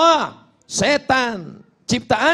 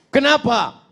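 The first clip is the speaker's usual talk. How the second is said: tuyul voice; 5 semitones higher than usual. Acted. Plays the tuyul answering.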